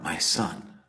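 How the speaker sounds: fading to a broken whisper of grief; a father's lament sinking into a hush; grief-stricken cry. Retold or imitated. David mourning his dead son Absalom, whispered grief. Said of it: "David cries out".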